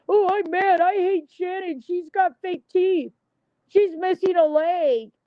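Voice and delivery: high, mocking sing-song of a petty ex; high mock whine, slowing at the end; mocking whiny voice. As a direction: sneer it out like a childish gossip. Mocking people who trash their exes.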